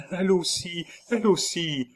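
high, repeated exclamation; startled realisation. A narrator's reading. Nurse thinking of his wife, high startled voice. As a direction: repeat it high, struck by the thought.

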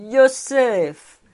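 high, loud cry of a name; joyful cry. A narrator's reading. Fritz greeting his old friend Joseph, crying out joyfully. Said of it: "en s'écriant".

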